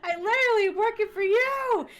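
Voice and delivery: high, loud mock outrage; exasperated high-pitched retort; acted-out yelling. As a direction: yell it back as in a catfight. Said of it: "they started yelling at each other".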